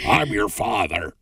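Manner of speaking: slow, drawn-out gruff-father impression; Nick Nolte. Shown as a demonstration. Host imitating an actor's role in Warrior, slow impression. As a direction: stretch it out as the actor's movie father. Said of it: "I can do a Nick Nolte impression".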